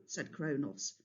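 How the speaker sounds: ordinary delivery, nothing marked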